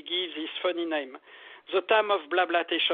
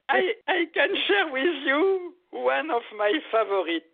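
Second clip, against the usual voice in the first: laughing while speaking; 7 semitones higher, 3 dB louder than usual. Real, not acted. Laughing after being asked for advice.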